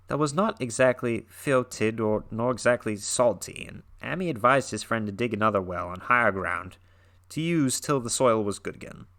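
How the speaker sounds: dramatic horror read-aloud, lightly intense; story narration. A narrator's reading. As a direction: read it like a spooky tale by the fire. Reads Lovecraft story aloud.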